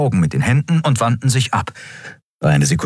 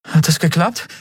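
unsure question; 10 semitones higher than usual. A narrator's reading. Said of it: "fragte Endriel unsicher".

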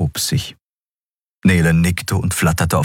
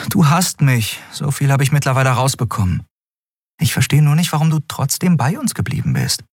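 put-on higher, urgent young woman's voice; Endriel, pressing; 4 semitones higher than usual. Captain pressing the silent Skria about their bond, urgent. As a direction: push him for an honest answer.

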